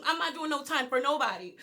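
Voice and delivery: ordinary talk, nothing marked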